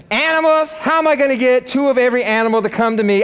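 high, mock-dismayed Noah; hammed-up exasperated objection; incredulous protest. Noah voiced objecting to God.

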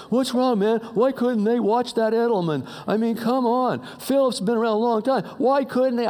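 mock gym-talk frustration; exasperated sports fans. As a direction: imitate guys complaining about the game. Acted gym men griping about game.

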